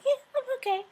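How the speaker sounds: high, small mocking voice of a reluctant wife; mock meek reply. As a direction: squeak it out like a wife who'd rather not talk. Mimicking wife's reluctant reply.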